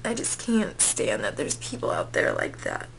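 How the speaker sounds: real crying, words squeezed out between sobs. Real, not acted. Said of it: "already getting me really choked up".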